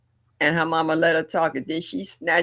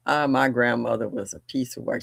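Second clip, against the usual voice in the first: plain reading voice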